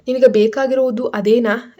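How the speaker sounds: ordinary delivery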